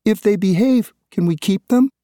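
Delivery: put-on high, hopeful little girl's question; tearful child. A narrator's reading. Daughter asking father if pets can stay, high tearful voice. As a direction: ask like a tearful child hoping. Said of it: "Kylie asked tearfully".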